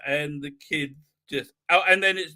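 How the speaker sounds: usual speaking voice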